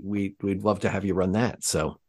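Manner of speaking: usual speaking voice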